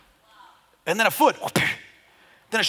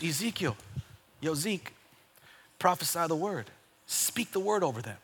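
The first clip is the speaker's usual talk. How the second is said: hushed command; 10 dB quieter than usual. Retold or imitated. God telling Ezekiel to prophesy, hushed command. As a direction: quietly voice God's instruction. God's command retold after the climax.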